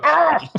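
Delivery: high yelp. Pretend. Scream in skit.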